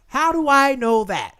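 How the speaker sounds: slow, high and loud mock challenge; indignant challenge. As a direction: confront the imagined caller, stretching each word. Indignant challenge to imagined caller.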